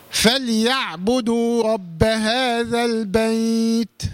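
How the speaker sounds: slow tajweed recitation, "البيت" drawn out at the stop; measured Quranic reading with "هذا البيت" linked; leen letter lengthened. Shown as a demonstration. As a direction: recite very slowly, stretch the ay of "البيت". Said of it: "حرف لين عند الوقف".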